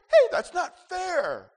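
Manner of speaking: loud, high, slow whiny protest; mock whine. Preacher mimicking a complaining believer, whiny and loud. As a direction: whine it like a wronged child. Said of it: "Whining and complaining".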